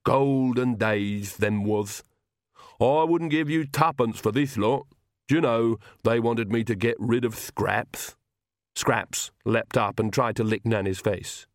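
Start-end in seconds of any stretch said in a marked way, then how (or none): 0.1-8.2 slow, wistful lisping retainer; lisping servant Igor, wistful. Acted. Igor grumbles to his guests, slow wistful lisp. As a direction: lisp it out slowly, with long vowels.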